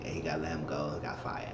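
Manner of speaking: usual speaking voice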